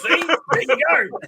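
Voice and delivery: high-pitched, laughing I-told-you-so; retold gleeful vindication. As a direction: crow it through a laugh. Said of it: "and I went".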